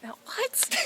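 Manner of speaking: very high, startled cry; stunned disbelief. Real, not acted. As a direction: caught completely off guard. Wife hears baby announcement.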